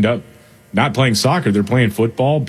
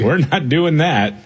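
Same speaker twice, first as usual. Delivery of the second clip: higher-pitched playful refusal; mock stern parent; 6 semitones higher than usual. Parent refusing the kid's British words, mock stern. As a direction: shut it down with pretend firmness. Acting parent banning the show.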